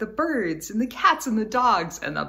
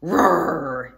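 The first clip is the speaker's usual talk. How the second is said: louder, lower playful lion roar; 5 semitones lower, 6 dB louder than usual. Shown as a demonstration. Teacher roaring along with the children, playful roar. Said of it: "Can you make the sound?".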